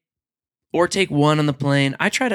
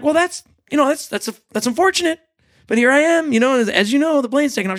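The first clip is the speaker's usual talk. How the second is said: high awkward excuse; 9 semitones higher, faster than usual. Retold or imitated. Own reply to agent, retold.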